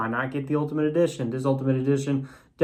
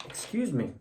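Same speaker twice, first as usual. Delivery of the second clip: talking through hiccups; interrupted by a hiccup; 8 dB quieter than usual. Real, not acted. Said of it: "Got the hiccups".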